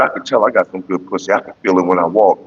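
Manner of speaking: low, husky mock woman's boast; sultry woman. A woman boasting to his homeboy, low and sultry. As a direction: purr it smugly, low. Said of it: "she said".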